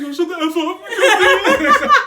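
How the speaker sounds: mock yell. Brother retelling yelling at his sister, laughing. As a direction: blurt it out, laughing. Retold angry outburst.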